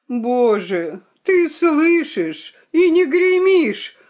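tearful, high, slow lament to heaven; mouse-brother cries out to God, tearful and high; crying plea. Acted. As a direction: wring your paws and wail. Заломил лапки мышебрат.

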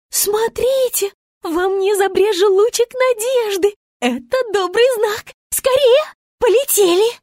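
высоко и громко, торопит; звёздочка загорается надеждой; воодушевлённая Звезда. A narrator's reading. (Звезда зовёт лететь, восторженно)

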